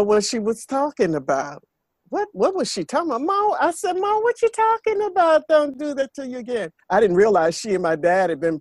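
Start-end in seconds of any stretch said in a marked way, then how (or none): 2.1-6.7 high, fast, puzzled voice of her younger self; confused younger self. Retold or imitated. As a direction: play your clueless young self, pitched way up and rushed.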